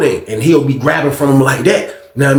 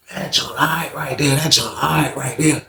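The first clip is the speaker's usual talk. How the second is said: mellow stoned verdict; 7 dB quieter than usual. Retold or imitated. Roommate high after smoking.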